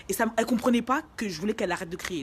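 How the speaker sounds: ordinary talk, nothing marked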